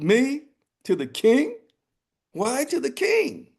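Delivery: high, squeaky mock astonishment; surprised rooster. Rooster feigning surprise at the king's summons, high-pitched. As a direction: play innocent, all wide-eyed bird.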